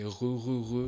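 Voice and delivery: re, re with a French guttural R from the throat. Shown as a demonstration. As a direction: growl the R at the back of the throat. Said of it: "Le R français".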